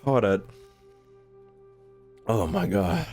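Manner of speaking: ordinary talk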